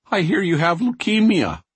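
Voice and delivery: put-on high, cracking teen voice. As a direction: let the voice crack with worry. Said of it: "His voice cracks".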